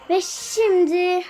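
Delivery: slow, drawn out build-up before the reveal; dramatic reveal. Real, not acted. Kid unboxing an epic reward for viewers, slow dramatic reveal. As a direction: announce it like a big unveiling.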